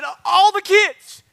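high, loud mock exclamation; emphatic aunt impression. Aunt to her nephew about the kids, loud and high. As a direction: cry it out high, imitating an astonished aunt.

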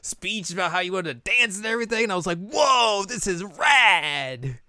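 high, gushing amazement; acted-out wowed friend; awed praise. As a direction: gush it, stretching the last words. Acts Kanan's awed praise.

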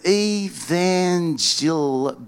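very slow, halting attempt at reading; sounding out. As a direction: puzzle it out bit by bit, breaking off. Sounding out an unfamiliar word.

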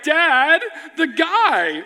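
high-pitched imitation of pleading children; imploring kids. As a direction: plead up high like a kid tugging at Dad. Kids urging dad to help.

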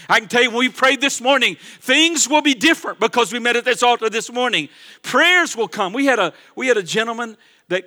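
loud, fervent preaching to the church; full-force preaching. Acted. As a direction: drive it home like a revival preacher.

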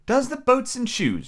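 high, slow, puzzled question; wondering. A narrator's reading. Alice puzzled by the Griffin's answer, wondering. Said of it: "repeated in a wondering tone".

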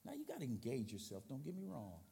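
quiet, low pastoral plea; soft earnest appeal. Acted. Pastor asking the church to commit, soft and low. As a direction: plead gently and quietly.

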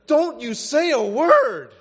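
high, slow, loud mock plea; frantic warning shout. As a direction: shout him down, drawn out and hammed up. Imagined reader silencing Peter.

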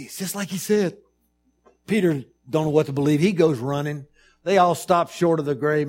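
loud, driving sermon retelling; excited preaching. Acted. Preacher telling of the empty grave, loud and fast.

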